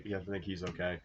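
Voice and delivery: normal talking voice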